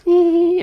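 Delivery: loud, very high "ee"; short mock pop-star yelp; high falsetto squeal. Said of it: "how [name] used to phone him".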